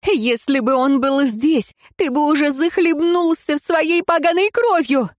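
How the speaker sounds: high, raging voice; яростная угроза; furious threat. A narrator's reading. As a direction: hurl the threat in fury. Prisoner rages at jailer.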